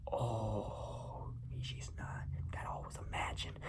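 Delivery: stunned whisper; hushed realisation. Retold or imitated. Moviegoer realising the twist, hushed whisper. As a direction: let it dawn on you in a whisper.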